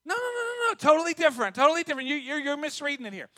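high, rapid-fire no-no-no; playful, high-pitched objection; mock correction.

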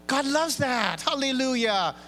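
ordinary talk

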